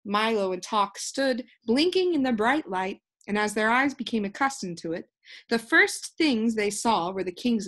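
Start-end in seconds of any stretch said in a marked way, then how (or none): none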